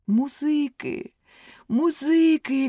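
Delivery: high, rising plea for music; desperate cry. A narrator's reading. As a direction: emperor shouting for music. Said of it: "кричав імператор".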